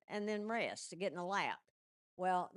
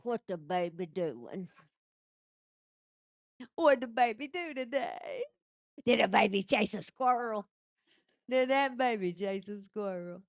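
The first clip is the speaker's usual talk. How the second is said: put-on dad's baby talk; 6 dB louder than usual. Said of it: "and go".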